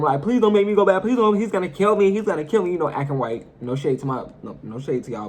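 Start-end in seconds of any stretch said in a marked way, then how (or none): 0.2-2.7 begging. Retold or imitated. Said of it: "And I'm begging him".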